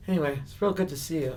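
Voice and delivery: high-pitched character sign-off; Andy Kaufman. Retold or imitated. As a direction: wrap up pleasantly in a put-on high voice. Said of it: "staying in the Andy Kaufman character".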